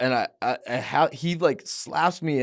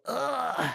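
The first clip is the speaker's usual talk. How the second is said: pained grunt; 12 semitones higher, 4 dB quieter than usual. Retold or imitated. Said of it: "I hear him go".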